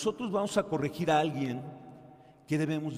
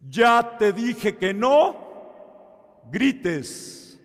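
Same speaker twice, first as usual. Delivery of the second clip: slow, loud, higher scolding shout; angry father; 8 semitones higher, 11 dB louder, much slower than usual. Retold or imitated. Father fed up with his screaming child, loud slow scolding. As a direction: drag out each word like a fed-up dad.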